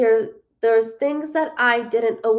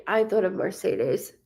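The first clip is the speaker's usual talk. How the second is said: ordinary talk, nothing marked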